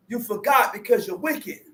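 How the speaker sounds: ordinary talk, nothing marked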